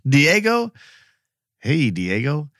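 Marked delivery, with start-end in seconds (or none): none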